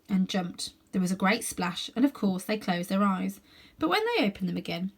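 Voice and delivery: ordinary delivery, nothing marked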